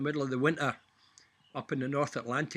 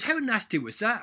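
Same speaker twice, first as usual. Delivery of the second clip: higher, louder wide-eyed lad; amazed apprentice; 9 semitones higher, 8 dB louder than usual. Retold or imitated. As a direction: gasp it like an impressed young apprentice. Apprentice voiced in joke.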